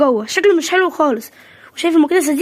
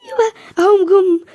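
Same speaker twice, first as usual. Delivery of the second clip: excited announcement; 6 semitones higher, slower than usual. Acted. Guests arriving, excited hostess.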